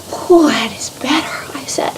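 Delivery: soft breathy whisper of relief; relieved whisper. Acted. Teacup sighing with relief on the shelf, whispered. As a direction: breathe it out, finally cooling.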